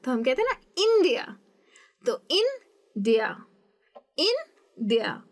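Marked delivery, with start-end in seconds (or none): 0.8-1.2 "India" with IN high and punched; front-stressed "India"; stress on first syllable. Shown as a demonstration. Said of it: "इस तरीके से stress होता है". 2.3-5.2 "India" slowly split, strong IN, pause, DIA; slow syllable demo of "India", IN stressed; stress on first syllable. Shown as a demonstration. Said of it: "इस तरीके से stress होता है".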